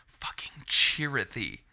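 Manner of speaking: a slow, hushed whisper of awe; slow reverent whisper. Real, not acted. Gushing over a favourite character, in a slow whisper. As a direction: breathe the name slowly, almost unvoiced.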